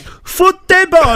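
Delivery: loud, high, hammed-up Italian; mock Italian accent. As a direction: belt it out like a cartoon Italian. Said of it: "is she really Italian".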